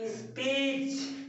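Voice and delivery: स्पीच with the ee slowly stretched; long sound. Shown as a demonstration. Teacher showing the long sound in स्पीच, slow. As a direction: draw out the vowel in स्पीच. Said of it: "long sound".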